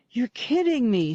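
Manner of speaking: a hurt, wounded protest; mildly hurt protest at being fooled; injured. A narrator's reading. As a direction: sound stung, a touch offended. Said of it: "said in an injured voice".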